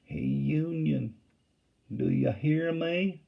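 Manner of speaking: slow, soft Southern drawl called across. A narrator's reading. Rebel picket calling softly across the river, slow drawl. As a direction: draw it out gently like a rebel picket. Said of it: "low and in a soft drawl".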